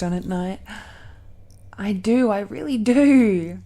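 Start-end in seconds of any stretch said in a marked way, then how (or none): 1.8-3.6 loud, emphatic protest; playful insistence. Acted. Dragon-blooded speaker convincing a doubter, emphatic insistence. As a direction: insist brightly that it's true.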